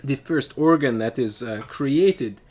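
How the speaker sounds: put-on loud, halting Russian-accented lecture; imitated Russian accent. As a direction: proclaim it in a mock Russian accent. Continuing her theory in her accent.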